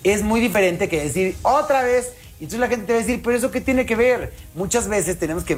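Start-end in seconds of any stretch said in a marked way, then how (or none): 1.4-2.1 queja actuada en tono más agudo; reproche exasperado. Acted. Contraste de reclamo mal formulado. 3.2-4.3 protesta actuada, aguda. Acted. Actúa la réplica de la gente.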